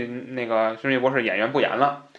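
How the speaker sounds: ordinary talk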